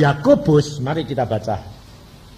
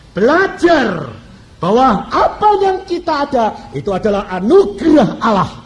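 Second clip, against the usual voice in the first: loud proclamation; 8 semitones higher, 7 dB louder than usual. Real, not acted. Preacher proclaims the main lesson.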